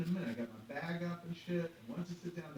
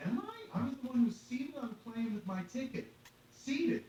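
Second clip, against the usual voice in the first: indignant retort; 10 semitones higher, 6 dB louder than usual. Retold or imitated. Retelling indignant reply to agent.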